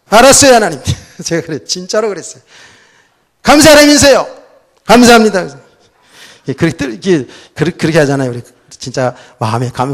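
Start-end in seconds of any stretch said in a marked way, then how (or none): 0.1-0.8 a loud, high, annoyed "알았어요"; irritated reply. Retold or imitated. Pastor answering God irritably, loud annoyed. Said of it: "너무 신경질 나가지고". 3.4-5.5 loud, high, sulky "감사합니다" thrown out; sulky thanks under protest; grudging thanks. Retold or imitated. Irritated pastor thanking God grudgingly, loud sulk. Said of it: "너무 신경질 나가지고".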